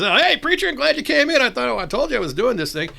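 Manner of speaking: high, loud mock-cheery welcome; eager greeting. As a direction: call out to the preacher across the room. Greets preacher in retold scene.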